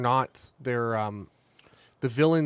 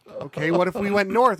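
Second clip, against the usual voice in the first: higher, hopeful question; nervous traveler; 7 semitones higher, 6 dB louder than usual. Retold or imitated. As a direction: ask the sheriff nervously. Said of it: "they're like".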